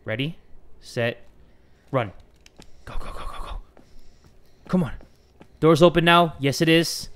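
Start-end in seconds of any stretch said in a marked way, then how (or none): none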